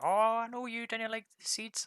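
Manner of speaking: put-on fond old woman reminding him; doting old lady. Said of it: "She's like".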